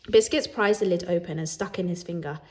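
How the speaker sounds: ordinary delivery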